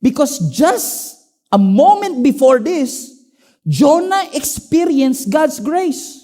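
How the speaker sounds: fervent slow sermon voice, each phrase drawn out; preaching. Acted. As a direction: preach it slowly to the congregation. Opening of sermon at full force.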